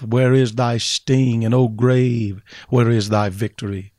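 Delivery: rising sermon cadence with rhetorical calls; preaching. Acted. Preacher turning the Joseph story on his church, growing fervour. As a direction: preach it out to the congregation, building.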